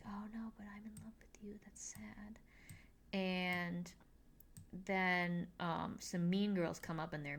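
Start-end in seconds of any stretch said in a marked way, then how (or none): 0.1-2.4 whisper. Retold or imitated. Penelope voiced, heartbroken aside.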